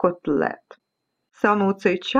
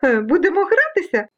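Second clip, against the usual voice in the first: high, inviting little voice; high-pitched playful storybook character; playful breeze; 8 semitones higher than usual. Acted. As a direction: coax them to play. Breeze invites specks to play.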